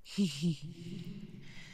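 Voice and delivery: quiet, knowing little chuckle; soft chuckle. Acted. Otherworldly being amused at a human, soft knowing chuckle. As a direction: chuckle softly to yourself.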